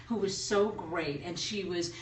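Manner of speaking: ordinary talk, nothing marked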